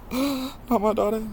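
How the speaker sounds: very high, drawn-out joke voice; mock childish. Son jokingly disowns his dad, mock childish. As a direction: stretch it out in a silly high pitch.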